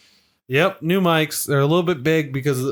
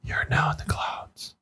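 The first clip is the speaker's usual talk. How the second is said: hushed dreamy whisper; ASMR whisper; 7 dB quieter than usual. Pretend. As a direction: whisper softly like guiding a meditation. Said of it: "I could create an A S M R channel now".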